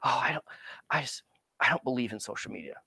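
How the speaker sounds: breathy mock-actor whisper, quick; hushed, mocking the actor who shuns social media. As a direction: whisper it like a precious artist. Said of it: "it just cracks me up when they're like".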